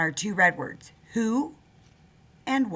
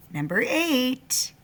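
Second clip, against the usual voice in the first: slow dictation; 6 semitones higher, much slower than usual. Shown as a demonstration. Teacher dictating spelling list.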